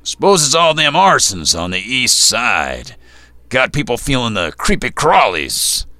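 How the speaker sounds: gruff bartender, grumbled loud and high; gravelly voice. A narrator's reading. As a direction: grumble it like a rough old barkeep. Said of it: "His gravelly voice".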